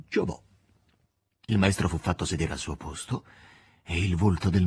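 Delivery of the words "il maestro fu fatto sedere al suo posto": usual speaking voice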